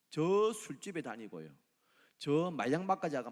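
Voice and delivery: usual speaking voice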